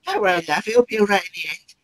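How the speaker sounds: reading the bust's line in a high squeaky comic voice; Joe Pasquale squeaky voice. Retold or imitated. Reading Ed the Head at rehearsal, high squeaky impression. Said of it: "I did this voice".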